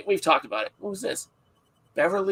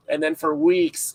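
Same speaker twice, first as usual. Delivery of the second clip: ordinary delivery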